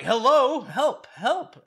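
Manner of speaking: high, loud mocking call for help; hammed-up pushy cry for help; mock rude yell. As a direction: yell it like a rude, impatient guy. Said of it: "Rob is rude as hell".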